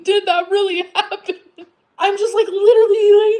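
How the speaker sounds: laughing. Real, not acted. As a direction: laugh helplessly through the words. Laughing while retelling.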